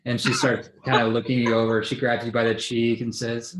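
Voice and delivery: usual speaking voice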